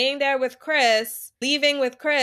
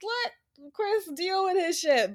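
a light mock of the group, higher and slower; mocking dismissive voice; 8 semitones higher, slower than usual. Retelling how the cast shut Alana down, mock dismissive. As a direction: sneer it down at her, half joking.

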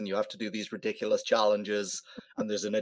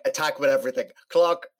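raised, punchy call, jumping higher at the end; mock calling out; 5 semitones higher, 4 dB louder than usual. Player demands the clock mid-game, mock calling out. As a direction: call it out at an opponent in mock urgency.

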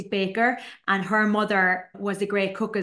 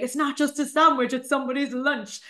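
higher, emphatic chef insistence; earnest correction; 4 semitones higher than usual. Retold or imitated. Grown-up chef correcting her younger self, emphatic and higher. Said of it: "Whereas now I'd be like".

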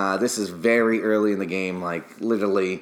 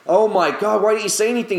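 shocked dismay; 11 semitones higher, 4 dB louder, faster than usual. Retold or imitated. Barber learns he burned client.

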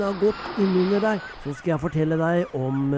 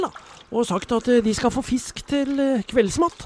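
ordinary delivery, nothing marked

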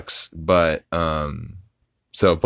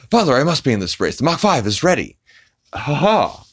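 fast, higher, overdubbed cartoon dad; Speed Racer dub; 8 semitones higher, faster than usual. Speed's father warns him off the race, fast cartoon dub. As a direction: rattle it off like a rushed old anime dub.

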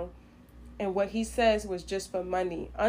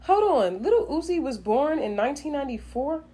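higher, louder disbelief; surprised; 7 semitones higher, 5 dB louder than usual. Real, not acted. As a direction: stop and react in shock. Shocked at the birth year.

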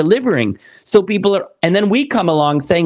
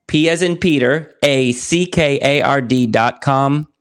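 spelling it out; 2 semitones lower than usual. Shown as a demonstration. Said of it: "can you spell that [name]".

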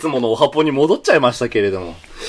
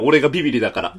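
normal talking voice

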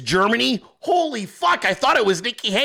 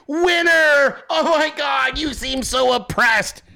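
overexcited mock cheer, higher pitch; mock gushing judges; 6 semitones higher, slower than usual. Comedian mocking pageant judges, gushing higher. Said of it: "And they were like".

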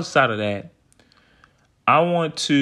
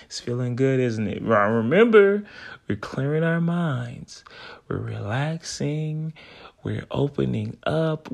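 guided meditation. Acted. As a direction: coax them to relax. Leads a breathing exercise.

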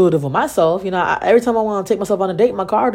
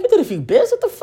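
much higher, disbelieving cry; shocked; faster than usual. Retold or imitated. Hearing he did bids, a high shocked cry. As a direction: squeak out your disbelief. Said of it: "so I'm like".

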